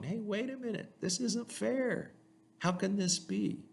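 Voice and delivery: a jealous sibling complaining it's unfair; indignant brother. Retold or imitated. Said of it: "His other brother's going".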